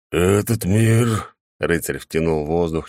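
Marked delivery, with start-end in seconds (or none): 0.1-1.4 awed. A narrator's reading. Knight awed by new world.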